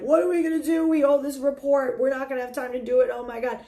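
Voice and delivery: high, fast, mock-panicked team; freaking out. Panicked team to their leader at work, freaking out. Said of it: "they're all freaking out".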